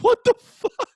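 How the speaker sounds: very high, loud comic outburst; exasperated disbelief. Retold or imitated. Bus rider telling of the mask selfie, loudly exasperated. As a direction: let the voice leap up in baffled outrage.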